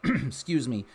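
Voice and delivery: usual speaking voice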